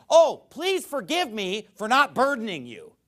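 loud, high mock apology; sarcastic. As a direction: plead for pardon with exaggerated sarcasm. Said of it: "He's you being sarcastic".

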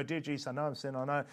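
hurried, mindless recital of a prayer; unthinking rush. Shown as a demonstration. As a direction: rattle it off without thinking. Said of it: "they're not really thinking".

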